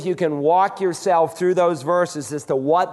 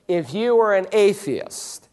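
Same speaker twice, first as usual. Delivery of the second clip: usual speaking voice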